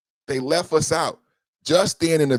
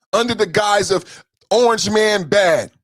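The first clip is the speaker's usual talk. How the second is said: ordinary talk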